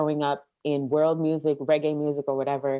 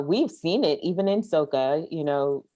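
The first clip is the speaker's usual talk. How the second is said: ordinary talk, nothing marked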